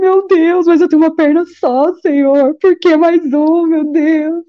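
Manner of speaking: high, slow pleading lament; tearful lament. Retold or imitated. Telling God she has one leg, tearful lament. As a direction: plead with God, half in tears.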